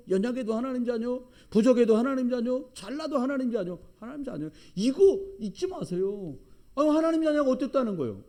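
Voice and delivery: loud, high chant of a refrain; fiery preaching. Acted. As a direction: hammer the refrain to the church. Preaching climax on being God's children.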